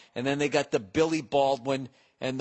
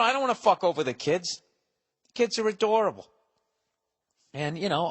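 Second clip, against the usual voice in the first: ordinary talk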